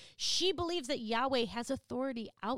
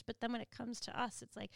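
ordinary talk, nothing marked

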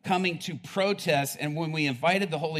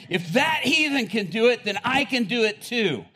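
excited pastor; 6 semitones higher, 6 dB louder than usual. Retold or imitated. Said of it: "he jumps out of his seat and he goes".

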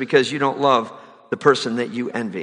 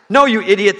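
a retold yell, only a bit higher and louder; mock yell; 6 semitones higher, 4 dB louder than usual. Husband on the phone to the doctor, a mild mock yell. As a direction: snap it out as the joke's flustered husband. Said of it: "the man yelled".

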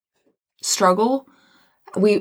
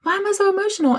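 much higher, exasperated repeat; high bewildered self-quote; 12 semitones higher, much faster than usual. Retold or imitated. To herself during prayer, high exasperated repeat. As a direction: pitch it way up, baffled. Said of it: "I'm like".

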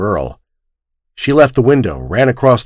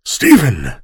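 calling out; 3 semitones higher than usual. Acted. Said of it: "He called".

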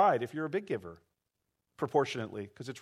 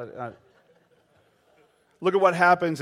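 usual speaking voice

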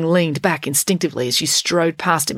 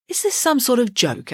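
nervous; 7 semitones higher than usual. A narrator's reading. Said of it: "asked Mister Lang nervously".

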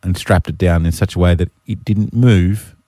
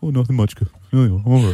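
put-on offhand English cabbie; mock English accent; 4 semitones higher, faster than usual. Cabbie shrugging off his day to the ex-wife, mock English accent. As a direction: play a bad English accent, casually. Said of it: "butchering this accent".

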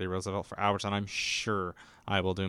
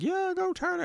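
much higher mock cheer from the crowd. Balcony crowd cheering Teddy on, much higher mock cheer. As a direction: squeal it like a fan in the balcony.

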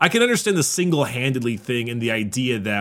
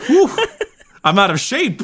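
laughing; 13 semitones higher, 7 dB louder than usual. Retold or imitated. Voicing him swimming through the warp.

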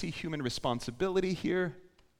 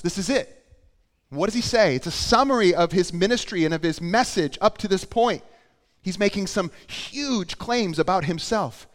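loud, raised, emphatic preaching; impassioned preaching; 6 semitones higher, 8 dB louder than usual. Real, not acted. Preacher summing up Jesus' huge claims, loud and emphatic. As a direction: preach the summary with force.

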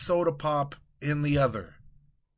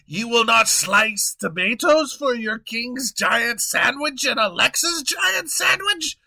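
loud, high indignant royal rant; a king outraged at being refused; pompous fury, louder and higher; frustrated king; 8 semitones higher, 6 dB louder than usual. Acted.

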